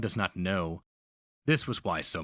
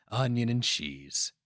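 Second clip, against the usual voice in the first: plain reading voice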